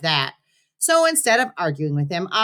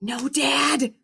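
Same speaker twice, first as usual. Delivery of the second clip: put-on whispered boy's line; 9 semitones higher than usual. A narrator's reading. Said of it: "I whispered".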